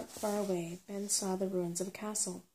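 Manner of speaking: usual speaking voice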